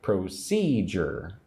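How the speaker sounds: slow procedure with SEE emphasized; stress on second syllable. Shown as a demonstration. Teacher showing emphasis on number two, procedure slowly stressed on SEE. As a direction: draw out procedure, leaning on the middle syllable. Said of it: "put the emphasis on number two".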